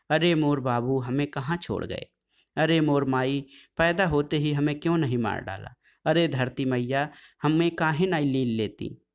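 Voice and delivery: plain reading voice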